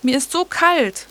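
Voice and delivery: put-on high, whiny little girl; pestering child. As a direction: whine about the cold. Caitlin keeps whining.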